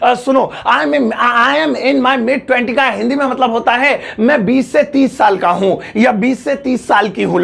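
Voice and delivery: normal talking voice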